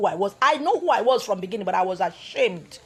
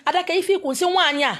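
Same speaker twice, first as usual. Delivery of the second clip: shocked bystander; 7 semitones higher, 4 dB louder, faster than usual. Retold or imitated. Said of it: "And the guy standing there say".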